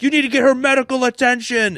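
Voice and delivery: high-pitched, urgent mock shouting; imitated panicked yell; frantic demand. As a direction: plead loudly as the desperate gunman. Acting the frantic son's demand.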